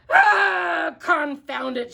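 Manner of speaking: loud, high furious cry; pirate shout. A narrator's reading. Captain Pete stomping his foot at the rascal, shouting. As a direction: stamp and bellow in frustration. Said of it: "shouted Pete".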